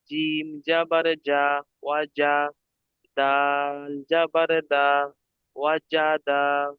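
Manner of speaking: slow, chanted-out syllables on a level pitch; teacher spelling it out slowly; segmented spelling. Shown as a demonstration. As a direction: break it into pieces and stretch each vowel. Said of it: "কেটে কেটে বানান করতে হবে".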